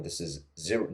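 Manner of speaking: ordinary talk, nothing marked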